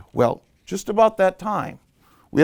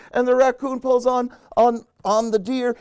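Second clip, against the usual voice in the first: excited climax; 7 semitones higher than usual. A narrator's reading. Chain of animals jolts, excited high narration. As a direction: race the chain reaction along on a raised voice.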